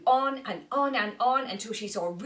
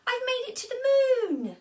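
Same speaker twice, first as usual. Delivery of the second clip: much higher, thrilled moth voice; excited cry; 7 dB louder, faster than usual. Acted. Molly lands and thinks she's on the moon, excited. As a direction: squeal it out in triumph. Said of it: "Molly arrives on the light bulb".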